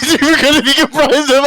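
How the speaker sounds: high, giggling loss of composure; cracking up mid-sentence; real laughter. Real, not acted. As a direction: laugh your way through the sentence. Laughing, losing his sentence.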